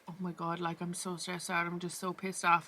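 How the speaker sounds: quiet stressed muttering. Retold or imitated. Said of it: "I was like".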